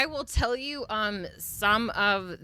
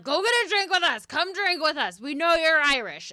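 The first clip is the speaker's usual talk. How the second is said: high, fast, insistent party ghosts; pushy Irish spirits; 8 semitones higher, 4 dB louder than usual. Retold or imitated. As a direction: egg her on to drink, quickly and brightly. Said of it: "They're like".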